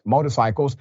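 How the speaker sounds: normal talking voice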